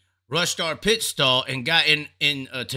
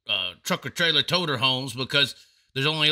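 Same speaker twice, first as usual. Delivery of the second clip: ordinary talk, nothing marked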